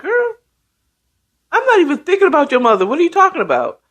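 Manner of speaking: high, rapid brush-off, acted out; exasperated denial. Woman denying she meant her mother, mock-exasperated. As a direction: act incredulous, rushing the words up high.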